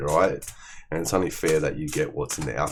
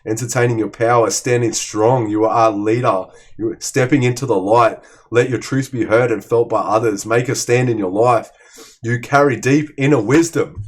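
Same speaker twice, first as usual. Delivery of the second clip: loud, higher, proclaiming each line; fired-up motivational read; rousing card reading; 5 semitones higher, 8 dB louder than usual. Acted. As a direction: preach the card's message out to the listener. Reads out card message loudly.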